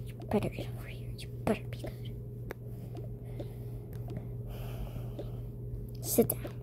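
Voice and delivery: hushed, stern whisper; whispered warning. Acted. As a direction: warn them quietly so guests won't hear. Mom scolds kids in whisper.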